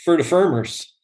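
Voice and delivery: exaggerated Iowa "For the farmers", the vowels bent toward "fer" and "fermers"; Iowa accent. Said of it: "I guess there's an Iowa accent".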